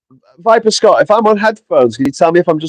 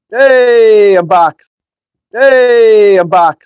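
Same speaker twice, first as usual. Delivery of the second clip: cheery greeting; 8 semitones higher, 7 dB louder, much slower than usual. Real, not acted. Returning to stream after fixing mic.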